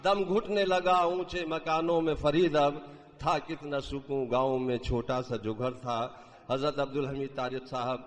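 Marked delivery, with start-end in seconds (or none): 0.0-6.0 declaimed Urdu verse; stately nazm recitation. Acted. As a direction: recite the couplet with grand feeling. Mushaira poetry recitation. (شاہانہ انداز میں نظم خوانی)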